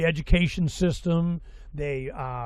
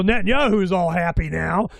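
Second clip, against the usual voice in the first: ordinary talk, nothing marked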